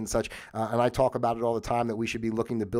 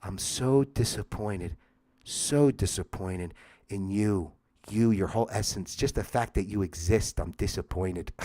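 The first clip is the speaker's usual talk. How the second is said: slow, low, quiet mock sorrow; deeply disappointed; 4 semitones lower, 3 dB quieter than usual. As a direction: drag out the disappointment heavily. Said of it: "nice passive aggressive way".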